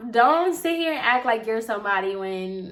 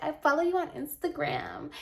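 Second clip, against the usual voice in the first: higher, softer mocking quote; put-on client voice, raised and softened; cringey client imitation; 6 semitones higher, 5 dB quieter than usual. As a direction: mimic her cringeworthy gushing, small and high. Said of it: "I cringed when she said".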